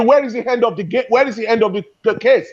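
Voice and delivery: usual speaking voice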